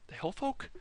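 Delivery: checking a half-remembered term aloud; doubtful question. Shown as a demonstration. Vlogger doubts the name for the tribes, rising unsure question. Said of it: "Oh, that might not be right".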